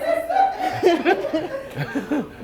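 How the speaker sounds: a high, bubbling laugh breaking the speech; bursting laugh. Real, not acted. Laughs mid birthday toast.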